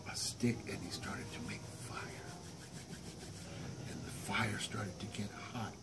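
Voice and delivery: secretive storytelling whisper; whispered narration. A narrator's reading. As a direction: tell it hushed, like a secret. Narrator whispers inside the monster.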